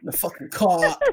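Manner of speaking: playful Boston-area tough talk; Wahlberg. Acted. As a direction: put on a New England accent. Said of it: "like I'm a Wahlberg".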